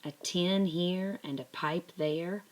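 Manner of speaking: usual speaking voice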